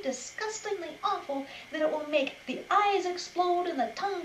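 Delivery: a hammed-up furious rabbit tirade; shouted rant. A narrator's reading. Pretty insulting the wolf, building loud rant. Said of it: "she shouted at the top of her voice".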